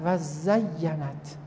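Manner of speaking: very slow, each syllable drawn out; slowly sounded out. Shown as a demonstration. Teacher dictating the word to a student, very slow. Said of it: "دو تا تشدید داره".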